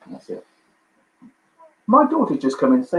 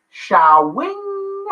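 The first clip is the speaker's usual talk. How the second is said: catchphrase cry. Retold or imitated. Quiz answer, Wayne's World catchphrase.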